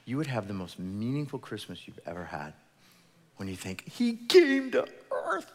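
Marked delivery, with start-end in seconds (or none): 4.0-5.5 high, slow and loud, full of wonder; awed exclamation. Acted. Pastor marvelling to the congregation, awed high slow cry. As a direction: stretch it out in awe. Pastor voicing awe at Christmas.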